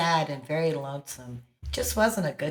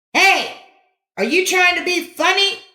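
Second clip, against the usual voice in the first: much higher and louder angry snap; snapped; 13 semitones higher, 10 dB louder than usual. A narrator's reading. Boy snapping at the kid across the valley, angry. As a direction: snap at him, offended. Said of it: "Johnny snapped".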